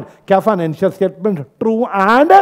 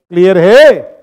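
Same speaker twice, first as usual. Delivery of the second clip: loud check-in to the whole class; calling out; 10 dB louder, slower than usual. Real, not acted. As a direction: call it out to rouse sleepy students. (ज़ोर से पुकारकर पूछना)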